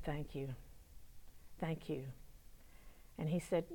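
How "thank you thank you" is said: hushed, low thanks; very quiet. Retold or imitated. Troubled young man thanking the woman who prayed, very quietly. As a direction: barely voice it, low and spent. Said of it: "Sam was just very quiet".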